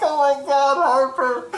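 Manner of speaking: very high, loud, shocked squeal; high-pitched outcry at a horrible joke; scandalized squeal. Retold or imitated. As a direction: squeal it, tickled and appalled at once. Cracked up retelling friend's horrible joke.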